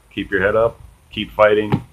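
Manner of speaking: ordinary talk, nothing marked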